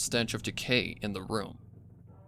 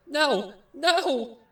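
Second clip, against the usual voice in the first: much higher, louder panicked cry; 7 dB louder than usual. Acted. Pinkamena resists the figure pulling her from the cage, screaming. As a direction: scream no as you fight to break free. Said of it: "Pinkamena cried out".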